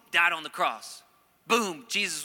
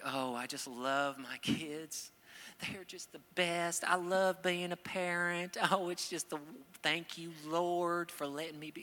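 mock doting parent; 3 semitones higher, 4 dB quieter than usual.

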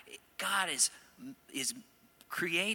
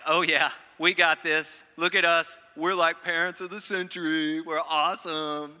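smug boast; 3 semitones higher, 7 dB louder than usual. Pretend. Smug parents cheering themselves on.

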